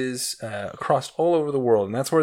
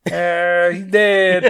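higher, hammed-up fake Egyptian lament; half-hearted fake accent, dead master; mock Egyptian accent; 6 semitones higher, 5 dB louder, much slower than usual. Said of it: "You gotta get into character".

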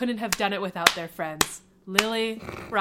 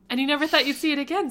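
excited rush; 7 semitones higher, 8 dB louder, faster than usual. Acted. Excited quick romantic exchange.

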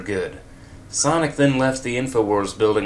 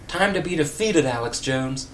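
higher, triumphant hero call; heroic shout; 6 semitones higher than usual. A narrator's reading. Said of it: "shouted Sonic".

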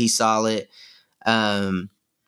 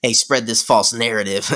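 normal talking voice

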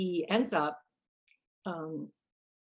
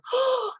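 sharp high gasp of horror; stagey gasp of realisation; shocked gasp; 6 dB louder than usual. Acted. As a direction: gasp as it sinks in. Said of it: "my whole face changed".

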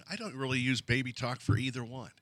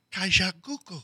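mock baby babble; 6 semitones higher than usual. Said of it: "I'm not in there going".

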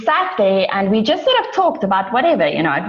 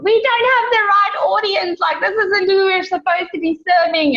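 retold crying; 11 semitones higher than usual. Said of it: "And I'm crying".

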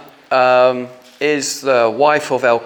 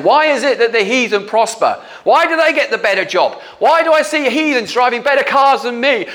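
indignant complaint; 9 semitones higher than usual. Retold or imitated. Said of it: "complete anger and, and the unfairness".

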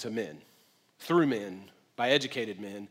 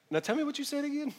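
much higher, softer, asking him to repeat himself; mock puzzled Mary; 12 semitones higher, 4 dB quieter, faster than usual. Pastor playing Mary puzzled by the angel's greeting, mock bewildered. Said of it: "But she was just like".

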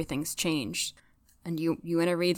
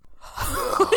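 high-pitched burst of laughter; real high giggle. Real, not acted. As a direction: crack up, pitch rising. Hosts crack up before final scene.

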